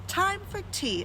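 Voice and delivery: a light sing-song host's call; announcing. A narrator's reading. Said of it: "announced Mister Wig".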